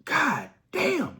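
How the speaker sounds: mock stunned outburst; retold amazement. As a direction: play the friend floored by the change. Friend's reaction retold.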